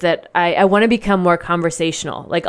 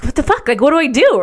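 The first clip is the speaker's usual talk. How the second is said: panicked; 7 semitones higher than usual. Retold or imitated. Panicking in too-advanced class.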